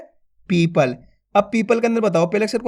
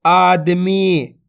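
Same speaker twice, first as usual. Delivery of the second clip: आदमी drawn out slowly, syllable by syllable; slowly blended word; much slower than usual. Shown as a demonstration. Teacher blending syllables for pupils, slowly. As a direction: stretch out आदमी. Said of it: "तो मिलके बन जाएगा".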